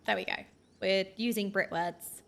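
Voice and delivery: usual speaking voice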